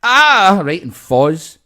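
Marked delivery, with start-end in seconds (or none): 0.0-0.6 sudden high yelp of pain; a loud startled "Ah!" imitating her; short pained cry, hammed up; mock yelp. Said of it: "And she goes".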